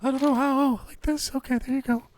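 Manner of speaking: high falsetto lady voice, a man in disguise; fake lady voice. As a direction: squeeze it up into a fake woman's voice. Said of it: "Disguise your voice like a lady?".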